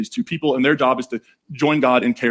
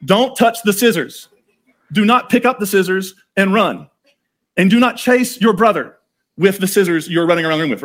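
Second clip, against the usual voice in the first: higher, louder mock-parent warning, with a laugh at the start; mock stern parent warning; 5 semitones higher, 4 dB louder than usual. Dad warns kids about scissors on coffee table, mock stern and louder. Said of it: "laying it on the coffee table and saying".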